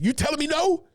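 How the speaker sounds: high, incredulous sergeant challenge; drill sergeant. Retold or imitated.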